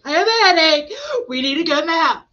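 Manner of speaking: put-on high, loud child whine; Selah being dramatic. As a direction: imitate a little girl announcing her suffering. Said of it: "She's over there all dramatic".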